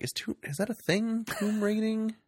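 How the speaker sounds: high, puzzled put-on voice; mock confusion. Retelling her baffled reaction to the film, high and mock-puzzled. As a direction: play dumb, way up high. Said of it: "And I'm like".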